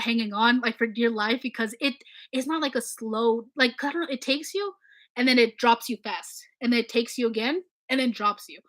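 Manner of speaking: usual speaking voice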